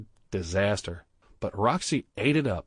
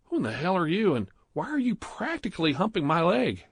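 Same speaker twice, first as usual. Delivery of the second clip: high, startled protest; alarmed cry; 9 semitones higher than usual. A narrator's reading. Stephen startled by a naked stranger, high alarmed cry. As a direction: recoil in shock as you shout it. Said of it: "Stephen cried out".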